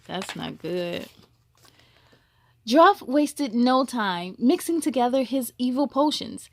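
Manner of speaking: plain reading voice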